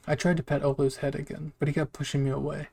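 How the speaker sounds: ordinary delivery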